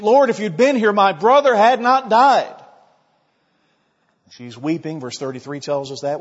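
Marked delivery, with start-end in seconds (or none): 0.0-2.5 anguished plea. Acted. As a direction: plead with Jesus like a grieving sister. Mary falls at Jesus' feet.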